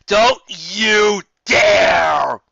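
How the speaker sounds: loud, very slow, stretched shout; loud drawn-out protest. Real, not acted. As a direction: protest with full force. Listeners demand story go on.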